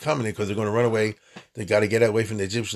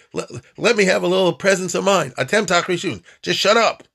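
fed up, louder, begging a moment to think; exasperated plea; 6 semitones higher, 4 dB louder than usual. Acted. Moshe wanting presence of mind, exasperated plea. Moshe pleads with noisy crowd.

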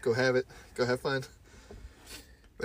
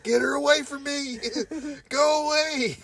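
high, louder frantic shout; panicked yelling; 4 dB louder than usual. Retold or imitated. Retelling a movie, the man shrieking for her to leave. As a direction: scream to get rid of her. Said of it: "He's like".

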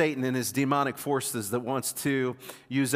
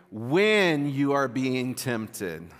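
"When" stretched out long and punched, slow cadence; stress on "When"; much slower than usual. Shown as a demonstration. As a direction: lean hard on "When", then read slowly for all to join. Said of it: "put the emphasis on the word when".

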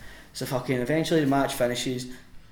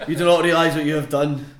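loud, higher, grand mock decree; all-caps proclamation; 4 semitones higher, 10 dB louder than usual. Opponent banning the wrestler by angry tweet, declaimed loudly. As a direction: proclaim it like a villain typing in capitals. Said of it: "all capital letters".

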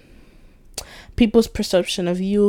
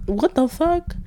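high-pitched baffled question; mock shock, rising at the end; retold disbelief; 8 semitones higher than usual. Reacting to being called motherly, retold disbelief.

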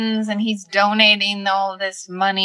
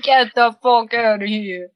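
New York accent; 3 semitones higher, 3 dB louder than usual. Shown as a demonstration. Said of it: "That's the one, right?".

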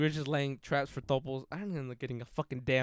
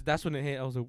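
ordinary talk, nothing marked

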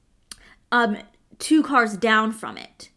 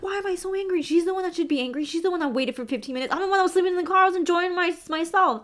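freaked out; 7 semitones higher, faster than usual. Retold or imitated. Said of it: "I freaked out because I'm like".

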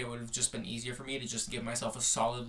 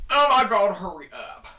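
much louder and higher; loud exasperated; 7 semitones higher, 13 dB louder than usual. Real, not acted. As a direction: blurt it out, exasperated. Impatient outburst at partner.

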